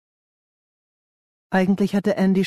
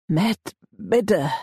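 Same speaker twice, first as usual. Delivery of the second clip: higher, anxious plea; pleading; 5 semitones higher than usual. A narrator's reading. Ben begs the gang leader for mercy, pleading. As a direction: beg him with the name first.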